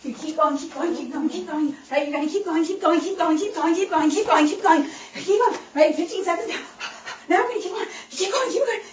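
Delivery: building from lively to loud, high urging; coaching cheer. Shown as a demonstration. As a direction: egg the runner on harder and harder. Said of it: "coaching a sprint demo".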